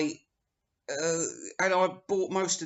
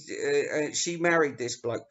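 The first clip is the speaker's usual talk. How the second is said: ordinary talk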